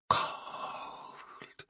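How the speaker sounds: barely audible, whispered mutter. A narrator's reading. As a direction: breathe it out drowsily, almost gone. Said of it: "muttered Fiver".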